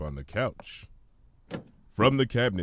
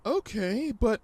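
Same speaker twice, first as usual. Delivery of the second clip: much higher, gentle pony voice; Fluttershy; 12 semitones higher than usual. Acted. Fluttershy answering the hummingbird, high and gentle.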